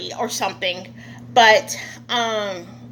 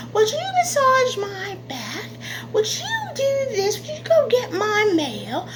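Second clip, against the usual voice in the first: much higher, syrupy sweet mock requests; sweet-talking slave owner; 9 semitones higher than usual. Slave owner sweetly ordering the slave around. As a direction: coax like a fake-kind master, pitched up high. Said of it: "it's gonna be like".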